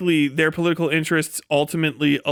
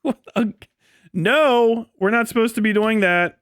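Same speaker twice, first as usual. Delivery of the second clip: shocked soldier; 6 semitones higher than usual. Pretend. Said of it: "you'd be like".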